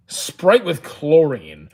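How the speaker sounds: very slow, drawn-out repeat; slow, incredulous echo of a viewer's question; drawn-out disbelief. Real, not acted. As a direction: stretch out each word in disbelief.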